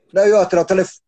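shouting. Real, not acted. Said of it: "eu não preciso gritar então".

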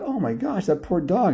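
high-pitched dismay; dismayed pity. Acted. Jake thinking about the poor dog, high dismayed pity. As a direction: gasp it out in sympathy, pitched high.

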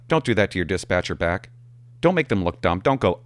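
ordinary delivery

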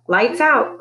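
mother calling lights out. A narrator's reading. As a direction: call it through the house. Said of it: "Clarice called".

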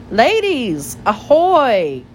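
jaunty gentleman calling out; hailing greeting. A narrator's reading. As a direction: hail them across the pond. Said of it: "greeted Mister Jeremy Fisher".

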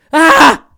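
sudden shrill mock scream, very high and loud; imitated scream. Retelling the night scream to a friend, sudden loud mock scream. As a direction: recreate the scream heard in the night. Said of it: "I just heard".

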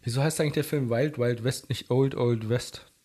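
usual speaking voice

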